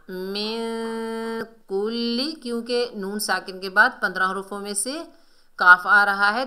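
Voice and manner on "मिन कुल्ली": slow मिन with long hum before कुल्ली; ikhfa recitation. Shown as a demonstration. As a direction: hide the n of मिन in the nose, then कुल्ली. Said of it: "इक्फा करेंगे".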